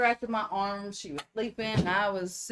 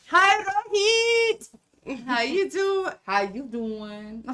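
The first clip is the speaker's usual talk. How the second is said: slow, high, drawn-out Bronx greeting; hammed-up New York "how you doin'"; Boston accent; 6 semitones higher, 5 dB louder, slower than usual. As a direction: stretch it out like a Bronx tough guy. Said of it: "the only way I could do a Boston or Bronx".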